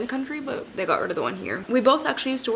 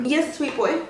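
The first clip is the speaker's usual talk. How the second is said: pet talk; 8 semitones higher than usual. Real, not acted. Interrupts to answer her dog.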